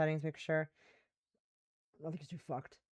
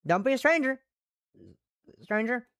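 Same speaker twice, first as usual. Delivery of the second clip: higher-pitched Southern farewell; Southern woman; 5 semitones higher, 4 dB louder, faster than usual. Acted. Saying goodbye to a new friend, in a Southern accent. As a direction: sign off in a folksy Southern accent. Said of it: "one female Western Southern voice".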